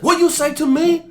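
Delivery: loud, very high rising challenge; indignant old man. Retold or imitated. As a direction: imitate an affronted old man squaring up. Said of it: "He was like".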